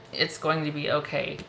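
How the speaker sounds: usual speaking voice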